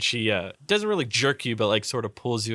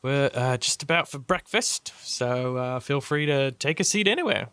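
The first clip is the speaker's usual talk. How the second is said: higher, lilting hostess voice; Lady Bianca, inviting; 4 semitones higher than usual. Acted. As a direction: offer a seat in character.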